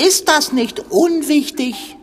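high, slow, prissy deflection; lofty, high, drawn-out dodge; evasive. Acted. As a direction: brush the question aside airily. Dodging blame in quarrel.

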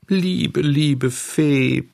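put-on high, thin childish begging; high, drawn-out plea; pleading. A narrator's reading. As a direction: beg the Fairy in a small voice. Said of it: "flehte Bengele".

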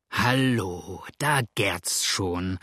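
low, slow, relishing exclamation; gleeful call. A narrator's reading. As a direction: savour the stirring crowd, low and drawn out. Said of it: "rief jetzt Cook freudig".

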